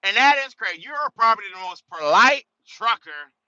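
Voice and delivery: high, loud, gushing praise; gushing receiver. Retold or imitated. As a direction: rave at him like an impressed receiver. Receivers praising the old trucker.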